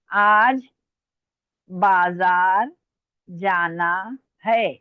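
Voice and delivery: very slow, pausing after each word; slow dictation. Real, not acted. Teacher dictating a sentence to students, slow dictation. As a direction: stretch each word for someone writing. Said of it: "मैं dictate करूं".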